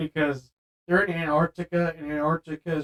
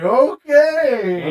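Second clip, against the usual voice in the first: taken aback; 10 semitones higher, 9 dB louder than usual. Retold or imitated. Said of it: "and you're just like".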